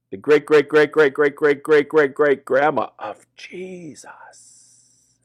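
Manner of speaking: drawn out. A narrator's reading. Drawn-out list of greats.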